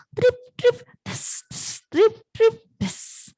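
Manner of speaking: high drips, whispered splashes; imitated rain drops. Storyteller shows what rain sounds like, high and whispered drops. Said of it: "Seperti suara hujan ya".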